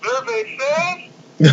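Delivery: high, very slow mock host call; game show host voice. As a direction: stretch it out like a quiz show announcer. Mocking game show host catchphrase.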